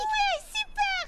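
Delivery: high, slow drawn-out cheer; gleeful super; delighted. Acted. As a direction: sing out the joy. Delighted reply.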